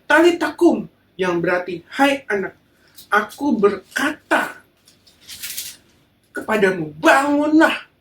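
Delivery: loud and high, a slow stretched "aku berkata"; loud, high command to wake; commanding call. Acted. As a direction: order the dead girl to rise. Jesus commands the girl to rise.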